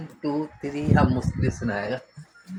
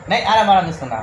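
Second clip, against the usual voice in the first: loud coaxing; 7 semitones higher, 10 dB louder than usual. Real, not acted. Father coaxing child to count.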